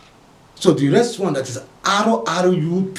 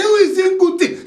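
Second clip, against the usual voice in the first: high shocked yell; shocked cry of who; startled shout; 6 dB louder than usual. Acted. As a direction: blurt it out in shock. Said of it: "He say".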